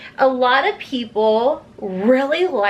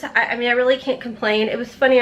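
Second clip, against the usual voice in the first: usual speaking voice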